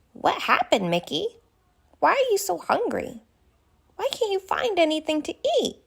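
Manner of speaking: put-on a kind, worried young giraffe asking questions; Ginger the giraffe. Narrator voices Ginger the giraffe.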